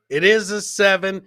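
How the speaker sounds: ordinary delivery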